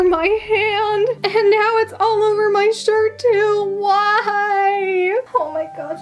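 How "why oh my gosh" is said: whiny fake sob of a why; mock cry. Real, not acted. Glitter on her shirt, she whines in mock tears. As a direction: wail it like a pretend sob.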